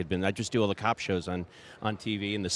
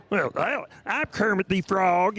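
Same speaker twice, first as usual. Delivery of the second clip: much higher, slow Kermit voice with a Southern accent; 9 semitones higher, slower than usual. Shown as a demonstration. Showing how voices combine, Kermit the Frog in a Southern accent. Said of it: "Kermit the Frog talked in a Southern accent".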